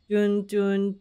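ordinary talk

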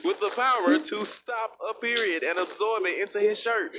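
usual speaking voice

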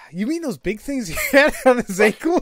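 high, loud, breaking into laughter; laughing while reading. Real, not acted. Retrying the game line about ankles, laughing. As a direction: push the line out, losing it to giggles. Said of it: "You can't even say it without laughing".

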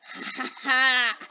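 slow, deliberate "ha ha ha" cackle; put-on villain laugh; mock evil laugh. Player announcing a return to viewers, mock evil laugh.

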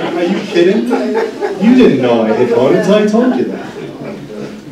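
high, laughing mock outrage; laughing disbelief. Nurse laughing at his mom after hitting bone. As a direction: tease her through laughter.

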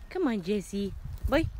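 ordinary delivery

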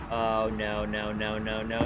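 low mock dismay. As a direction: moan it like a dazed principal. Principal voice, low and far.

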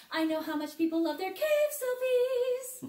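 slow, sing-song teasing. Acted. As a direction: tease the group in a lilting tune. Guide's playful aside.

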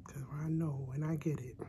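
soft secret whisper. Real, not acted. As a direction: whisper it like a guilty confession. Whispered confession, don't laugh at me.

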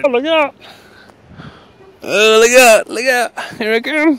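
high, loud playful call; playful warning shout. Real, not acted. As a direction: shout a jokey warning as you slide. Dad calls out going down.

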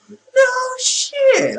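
very high, drawn-out mock surprise; sarcastic retort. Real, not acted. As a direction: squeal it slowly at the obvious tip. Answers the game's tip.

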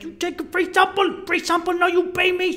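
very high, frantic Colombian tout impression; shouting hustler. Hustler fighting to sock the coke, shouted impression. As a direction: yell the demand as the imitated hustler. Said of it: "this little fight where I'm taking it out".

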